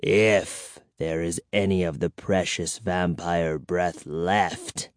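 put-on low, soft, bitter old vampire. A narrator's reading. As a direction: say it with quiet resentment. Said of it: "he said bitterly".

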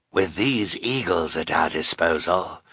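sinister man's hoarse voice. A narrator's reading. Sinister man plotting with the eagles, hoarse. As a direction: speak hoarsely, with menace. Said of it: "said in his hoarse voice".

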